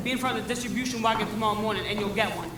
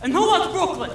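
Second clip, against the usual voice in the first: loud, raised-pitch orders; calling out assignments; 6 semitones higher, 4 dB louder than usual. Acted. Strike leader handing out territories to the newsies, rallying. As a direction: call out to the whole gang.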